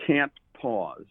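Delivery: can't pressed hard, falling on pause; stress on can't. Real, not acted. Guest quoting a Wall Street note, stressing can't. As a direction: lean on the word can't. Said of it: "I emphasize the word can't pause".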